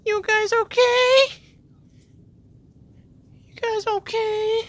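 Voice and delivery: high, loud sing-song check on the pets; high call to birds. Real, not acted. Owner calling out to her pet birds, high and loud.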